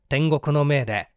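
ordinary talk

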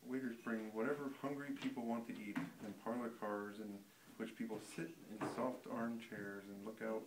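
ordinary delivery

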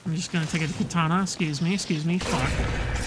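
ordinary talk, nothing marked

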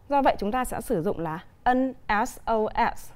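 ordinary delivery

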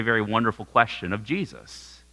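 ordinary delivery

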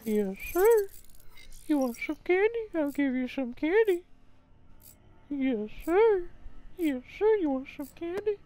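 high sugary creepy stranger voice; sweet creepy coaxing. Pretend. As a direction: lure a kid with candy, sing-song. Same creepy voice.

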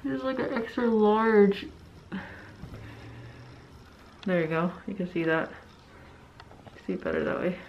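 muffled by cloth mask; speaking behind fabric covering; through a face mask. Real, not acted. Seen 3: Orange cloth mask covers mouth and nose throughout labelled words.

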